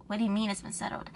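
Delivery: subdued retold question, hurried; restrained reply. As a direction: hold back and ask it quietly. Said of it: "that's what I said in my head".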